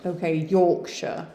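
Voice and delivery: ordinary delivery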